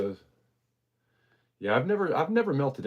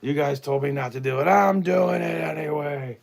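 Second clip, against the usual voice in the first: goofy villain voice; 7 semitones higher, 6 dB louder than usual. Pretend. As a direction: gloat like a cartoon villain. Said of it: "something goofy like that".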